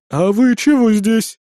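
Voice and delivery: drawn-out, severe questioning; suddenly stern. A narrator's reading. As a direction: turn stern and demand an explanation. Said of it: "внезапно посуровила Хель".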